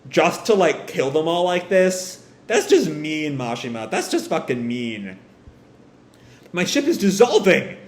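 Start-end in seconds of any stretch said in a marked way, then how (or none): 6.5-7.8 acted alarm, pitched higher. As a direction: report disaster in mild panic. Character alarmed at dissolving ship.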